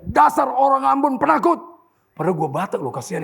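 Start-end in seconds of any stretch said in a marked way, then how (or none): 0.0-1.6 loud, high taunting shout; retold yell. Angry rider shouts at the driver behind closed window, retold yell. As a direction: yell it as an insult across the road. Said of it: "Dia teriak".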